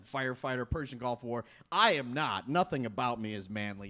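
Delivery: ordinary talk, nothing marked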